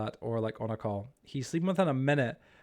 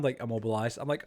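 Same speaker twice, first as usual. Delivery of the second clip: ordinary delivery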